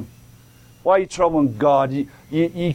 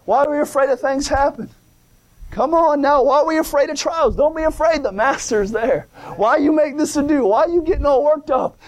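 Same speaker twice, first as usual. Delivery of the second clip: raised, pressing sermon voice; preaching at full force; 9 semitones higher than usual. Real, not acted. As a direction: preach it hard and high to the church.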